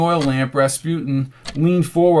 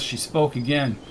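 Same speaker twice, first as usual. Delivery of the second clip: plain reading voice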